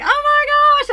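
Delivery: high, loud squeal of delight; real giddy shriek; thrilled squeal. Real, not acted. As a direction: squeal it out, overjoyed. Thrilled reaction to his text.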